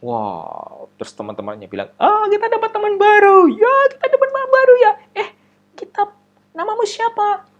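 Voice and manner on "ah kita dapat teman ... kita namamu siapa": teasing classmate. Acted.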